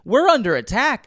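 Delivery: high, loud alarm call; urgent yell. Retold or imitated. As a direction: snap them out of resting. Yelling at his unit.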